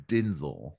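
Denzel said DIN-zel with an i vowel; Denzel with I sound. Shown as a demonstration. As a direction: say Denzel as Dinzel, low. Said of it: "Like D I N".

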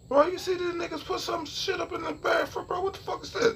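high, hushed incredulous voice; incredulous whisper-yell at the manager; mock outraged self. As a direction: act shocked at the sign, voice jumped up. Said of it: "I say".

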